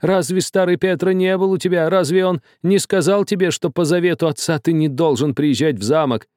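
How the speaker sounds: high-pitched frantic reproach, building; agitated shouting. A narrator's reading. Old man rebukes Karlo for defying father, shouting. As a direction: cry out in horrified rebuke, pitch kept high. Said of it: "кричал весь, трясясь, старик".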